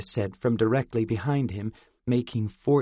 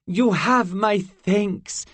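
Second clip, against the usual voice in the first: higher, slow, formal fairy; formal fairy thanks; 9 semitones higher, 5 dB louder, much slower than usual. A narrator's reading. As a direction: thank them all with grace.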